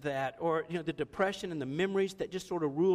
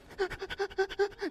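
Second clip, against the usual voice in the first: high-pitched burst of laughter; laughing at the memory of his dad; 13 semitones higher than usual. Real, not acted. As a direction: let a real laugh break out. Laughing at his own story.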